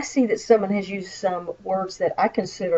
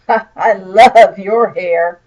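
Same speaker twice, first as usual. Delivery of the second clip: very slow, drawn-out love, louder, dripping irony; sarcastic; 9 dB louder, much slower than usual. Shown as a demonstration. Teacher shows the same compliment said sarcastically. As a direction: say it so she knows you don't mean it. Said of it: "probably being sarcastic".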